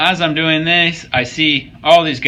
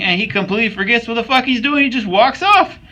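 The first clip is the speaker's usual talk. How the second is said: rising, high-pitched disbelief; incredulous rant; 10 semitones higher than usual. Real, not acted. Lifter telling viewers about a distracted gym-goer, incredulous.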